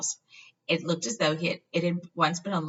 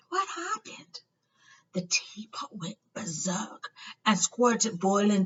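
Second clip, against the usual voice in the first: higher whispered words; hushed story; 5 semitones higher, slower than usual. A narrator's reading. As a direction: hush it like a secret. Whispered exchange.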